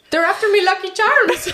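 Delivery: high, hammed-up Irish leprechaun accent. Teased about accents, she squeals a mock Irish leprechaun line. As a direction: ham up a cereal-ad Irish accent. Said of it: "your British accent is not British".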